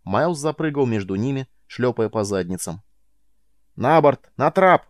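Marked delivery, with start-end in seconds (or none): 3.7-4.9 shouting orders. Acted. Miles herding prisoners aboard.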